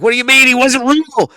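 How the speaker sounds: high, loud, incredulous yell; mock his meltdown over the mannequin; imitating outraged Sami. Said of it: "He's like".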